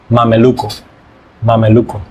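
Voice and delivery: careful Spanish pronunciation of Mameluco; Spanish word demo. Shown as a demonstration. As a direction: roll out Mameluco with tapped Spanish vowels. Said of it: "Do you think Spanish pronunciation is difficult?".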